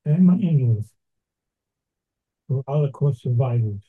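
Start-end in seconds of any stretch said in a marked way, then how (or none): none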